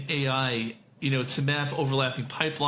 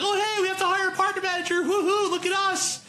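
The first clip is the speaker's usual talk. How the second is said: very high mock-excited voice; hammed-up high-pitched glee; giddy company. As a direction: squeal it like a startup cheering itself. Mocking an overexcited company.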